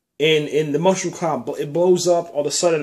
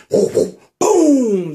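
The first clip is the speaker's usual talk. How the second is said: loud, higher imitated boom; explosion noise; 7 semitones higher, 6 dB louder than usual. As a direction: burst out with a big explosion sound. Fire truck explodes.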